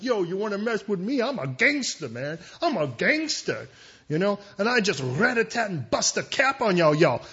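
high, loud gangster swagger; young gangster. Acted. Young hotheads urging the king to hit hard, mock gangster bravado. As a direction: play a hammed-up tough guy picking a fight. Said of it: "another group of people that are like".

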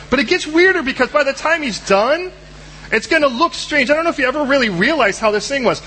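ordinary talk, nothing marked

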